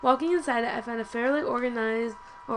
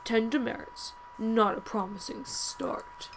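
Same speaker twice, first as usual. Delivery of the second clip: measured, deliberate scolding from a strict professor; stern professor. Acted. Professor warning a student of expulsion, stern and measured. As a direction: weigh each word like a disapproving teacher.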